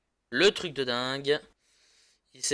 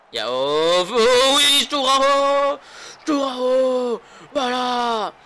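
esports caster; 8 semitones higher, 8 dB louder, much slower than usual. Real, not acted. Goal celebration, excited commentary.

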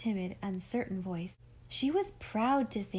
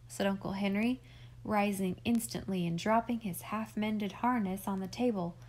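ordinary delivery, nothing marked

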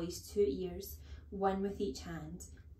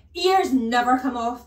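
loud, dismissive shout; 5 semitones higher, 15 dB louder than usual. Acted. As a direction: bellow it as settled fact. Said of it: "the Trunchbull shouted".

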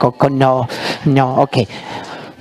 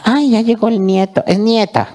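puppet voice; 6 semitones higher than usual. Acted. Puppet remark, high.

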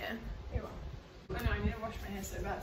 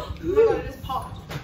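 ordinary delivery

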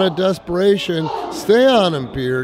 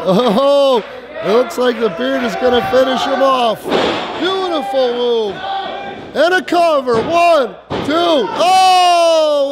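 excited; 5 semitones higher than usual. Real, not acted. Commentator expects the finish.

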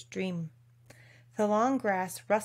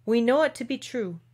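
ordinary delivery, nothing marked

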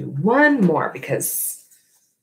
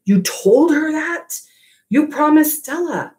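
higher, stunned accusation in a put-on voice; accusing; 13 semitones higher than usual. Ivan confronting Bob about the promise, high accusing voice. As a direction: throw his broken promise back at him.

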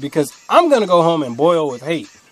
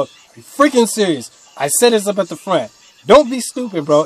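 louder, higher exasperated scolding; 5 semitones higher, 5 dB louder than usual. Retold or imitated. Cashier snapping at a man asking a fourth time, exasperated. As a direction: finally lose patience with him. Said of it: "it took a lot for me to go".

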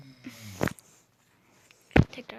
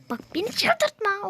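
exasperated warning; 8 semitones higher, slower than usual. Real, not acted. Warning the game.

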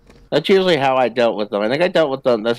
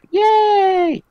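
a high squealing yay; squeal of joy; 4 dB louder than usual. Real, not acted. Friend cheering her own small win, squealing. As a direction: cheer like you just won. Cheering after covering the frog.

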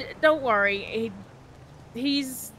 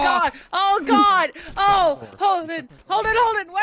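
high, loud cries of alarm; alarmed, high-pitched shouting; panicked yelling; 4 semitones higher, 4 dB louder than usual. Pretend. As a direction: call his name in rising panic. Panics as Holden gets hurt.

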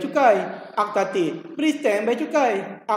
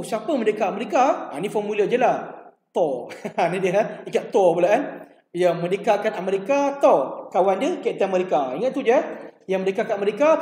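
ordinary talk

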